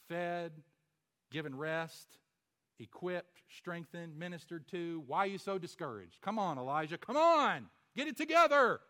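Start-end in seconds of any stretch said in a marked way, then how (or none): 5.1-8.8 rising to a loud exasperated call; exasperated urging. Acted. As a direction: shake him out of it, louder each time. Preacher exhorting Elijah.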